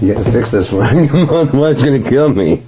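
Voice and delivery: high pleading through laughter; laughing mock plea. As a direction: plead with the doctor, giggling. Acts parent pleading with doctor, laughing.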